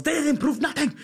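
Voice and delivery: very high mock German outburst; hammed-up German accent, pitched high; German accent slipping out. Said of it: "this German accent comes out".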